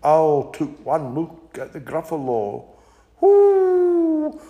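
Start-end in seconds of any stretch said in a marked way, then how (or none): 3.2-4.4 high, sustained owl hoot; long high "whoo". Acted. Owl hoots in the story.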